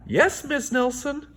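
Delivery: put-on high sing-song chorus of kids; kids answering. A narrator's reading. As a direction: chant it like a class of obedient kids. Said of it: "said the kids in two oh seven".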